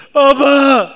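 imitated mourner shouting at the grave; calling out. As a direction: wail אבא at the grave.

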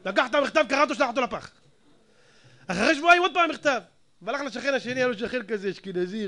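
ordinary talk, nothing marked

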